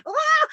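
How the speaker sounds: high, loud release scream; wild scream. Retold or imitated. Class members screaming out their tension, high and loud. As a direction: scream it all out. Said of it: "I just watch them going".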